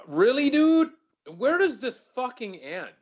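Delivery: loud, high exasperated really dude; indignant outburst. Real, not acted. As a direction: blow up in disbelief at the bill. Ranting about the toy bill.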